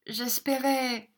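high, slow, failing voice; faltering. A narrator's reading. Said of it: "d'une voix défaillante". (d'une voix défaillante)

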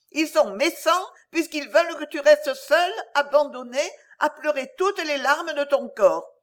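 put-on a child stamping her feet in a rage; stamping in anger. A narrator's reading. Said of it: "trépignant avec colère". (colère d'enfant qui trépigne)

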